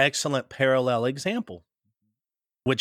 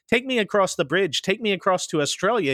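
higher, insistent tourist repeating his request; a pitched-up, exasperated retelling of the demand; 6 semitones higher than usual. Retold or imitated. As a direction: press the point like a confused tourist. Businessman insists on the crossing.

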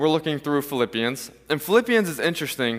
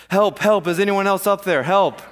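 loud, higher acted yell for rescue; yelled cry for help; 5 semitones higher, 5 dB louder than usual. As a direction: holler for help at anyone above. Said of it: "Finally, Jack yelled".

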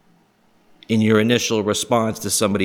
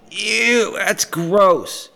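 high-pitched squeamish ew; mock disgust; 10 semitones higher, much slower than usual. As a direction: squeal it like a grossed-out listener. Acts out everybody's disgusted reaction.

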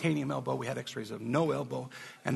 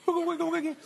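mock wiggle; 4 dB louder, faster than usual. Doctor's test mimicked.

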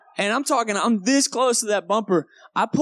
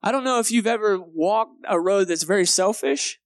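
ordinary talk

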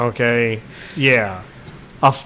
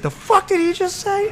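a squeaky, much higher Falcon voice; high mock Falcon. Falcon outraged at Thanos, squeaky high mock voice. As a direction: shriek the line up high as Falcon. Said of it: "Falcon's like".

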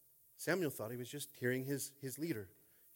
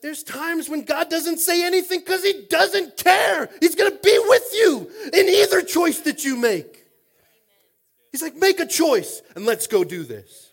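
preaching at full force; 11 semitones higher, 19 dB louder than usual. Acted. Pastor urges the congregation to decide, shouting. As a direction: drive it home with a cry in the voice.

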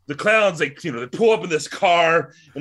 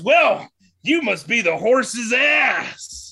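slow, loud, higher mocking shout; clown punchline shout; 7 semitones higher, 4 dB louder, much slower than usual. Clown mocks Joe before the audience, slow loud higher shout. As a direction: belt it slowly to the whole crowd.

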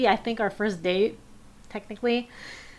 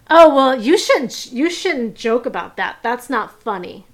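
terse and curt; 3 semitones higher, 7 dB louder than usual. Retold or imitated. Stepdad rebuking a joke about his stepson, terse and curt. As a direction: imitate a curt scolding, hitting the start hard. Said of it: "it was just very terse and curt".

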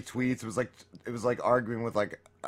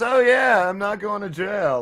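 mocking voice; 7 semitones higher, 6 dB louder, slower than usual. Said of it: "then he goes".

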